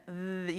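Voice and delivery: TH drawn out with a tickling vibration; voiced th. Shown as a demonstration. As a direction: let "th" buzz on the tongue. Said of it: "You feel the vibration".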